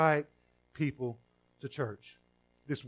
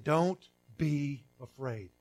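slow quote; much slower than usual. Retold or imitated.

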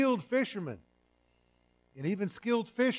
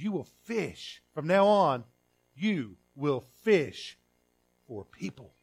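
slow emphatic quote; slower than usual. Retold or imitated. Quoting Jesus slowly, emphatic.

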